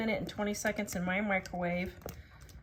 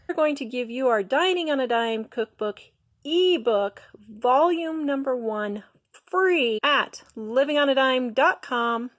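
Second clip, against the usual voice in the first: ad read; 6 dB louder than usual. Acted. Ad read for ebook.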